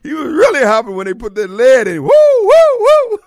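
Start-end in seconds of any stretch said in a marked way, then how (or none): 2.0-3.2 high, loud, slow woo woo woo; mock whoops. Host mimics the hopping man, loud high whoops. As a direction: hoot it out like a cheering crowd.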